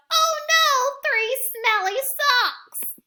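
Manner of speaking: high, loud, slow dismayed shout; Oh no, shouted high and drawn out. Acted. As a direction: yell it out in disgust at the socks. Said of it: "and shouts".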